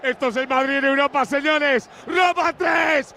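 stretched, high shouted commentary; frenzied goal call. Real, not acted. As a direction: roar it, stretched out.

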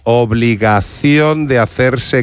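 drawn-out syllables with weighty pauses; slow and deliberate. Real, not acted. Explaining to slow-witted councillors, slow and deliberate. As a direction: spell it out very slowly, word by word. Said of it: "lo voy a decir despacio".

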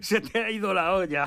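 high, talking through laughter; laughing disbelief. Acted. Prisoner mocking old inmate's escape plan, laughing. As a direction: laugh at him as if he's crazy.

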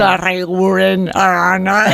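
"Sarreguren, Arana" drawn out slowly, mimicking foreign announcers; playfully exaggerated mispronouncing of "Sarreguren, Arana"; mock foreign mispronunciation. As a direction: stretch out "Sarreguren, Arana" as outsiders mangle them. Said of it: "cómo pronuncian nuestros nombres por ahí".

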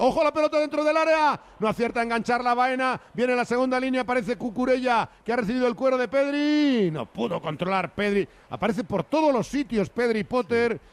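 high, excited football commentary; urgent radio commentator following the ball; live football play-by-play. Real, not acted.

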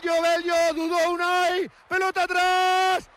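high panicked yelling; alarmed shout. Real, not acted. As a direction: yell in alarm at the danger. (grito de alarma muy agudo)

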